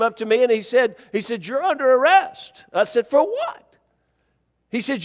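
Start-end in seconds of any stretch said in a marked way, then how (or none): 1.4-2.6 higher-pitched imitation of an arresting officer; retold officer's arrest. 3.1-3.6 retold incredulous question. Retold protest at arrest.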